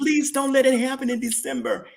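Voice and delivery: high, insistent begging prayer; imitating an earnest appeal to the Lord; retold plea. Repeats his retold plea in prayer.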